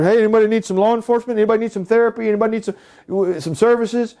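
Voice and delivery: high, fast canvassing patter; mock door-to-door salesman. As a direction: call out a mock sales pitch, high and quick. Mock door-to-door pitch for services.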